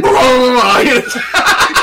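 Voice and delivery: loud, high mock ghost roar; jump-scare monster noise. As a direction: burst out as a silly lunging ghoul. Imitating ghost lunging at camera.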